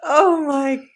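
slow, high through laughter; laughing. Real, not acted. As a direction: gasp it out laughing. Listener laughing helplessly.

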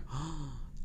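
soft acted gasp; retold gasp of disbelief; shocked gasp. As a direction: suck in a quiet, startled breath. Retold shock at the lady's answer.

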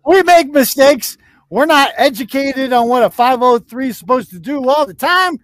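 high, loud mock excuse-making; mocking imitation. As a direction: parrot the excuse in a hammed-up high voice. Mocking the played clip's excuses.

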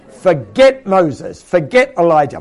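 slow, loud forget Moses; loud emphatic dismissal. Real, not acted. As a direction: hammer each name away.

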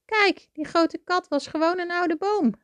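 high, amused rabbit voice, no real laugh; laughing reassurance. A narrator's reading. Rabbit showing Toby the harmless tree, high amused voice. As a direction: point it out merrily, pitched high. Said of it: "lachte Wolletje".

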